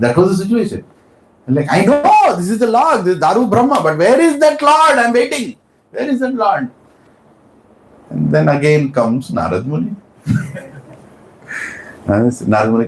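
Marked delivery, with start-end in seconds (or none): 1.8-6.7 high, pleading search for the Lord; king waiting for his Lord; yearning king. Acted. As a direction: call out for him.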